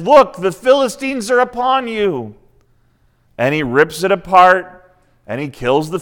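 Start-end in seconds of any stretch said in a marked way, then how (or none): 0.0-2.3 higher retold warning cry; mock alarm. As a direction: sound the alarm, half-hammed. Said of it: "And then she says".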